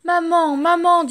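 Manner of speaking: high, loud plaintive cry; loud moaning cry; wailing for mum. Acted. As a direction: call for his mother through tears. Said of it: "gémit Dumbo".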